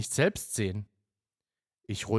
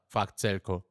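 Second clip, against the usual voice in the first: usual speaking voice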